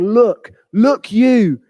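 slow, pointed accusation; accusing. Acted. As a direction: jab each word at him slowly. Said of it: "He's pointing straight at him".

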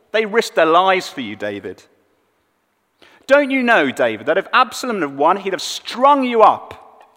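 a general berating his king; angry rebuke. Retold or imitated. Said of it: "Joab barges in on the king".

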